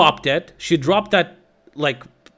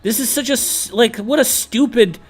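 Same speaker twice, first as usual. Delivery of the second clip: higher, exasperated disbelief; incredulous; 8 semitones higher than usual. Retold or imitated. Retelling an argument with her, higher mock-incredulous scoff. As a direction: scoff at her position as if stunned. Retold argument, quoting himself.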